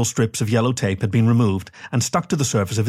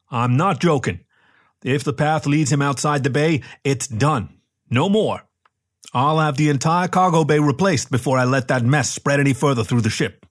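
lightly voiced, adamant captain, a little higher; insistent captain; 4 semitones higher than usual. A narrator's reading. Captain forbidding the mess from spreading through the ship, lightly voiced insistence. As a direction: lay down the law, mildly heightened.

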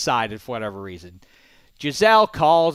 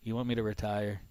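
quieter, lower quarterback giving in; husband agrees to retire, softer and lower; playing Tom; 4 semitones lower, 7 dB quieter than usual. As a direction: give in to the wife softly. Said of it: "I'll be Tom".

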